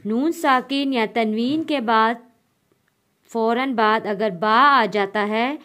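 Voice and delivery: normal talking voice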